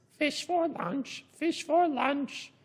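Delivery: high, slow parrot squawk. Acted. Parrot echoing Pirate Pang at lunch, high squawk. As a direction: repeat it like a parrot. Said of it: "Parrot repeats".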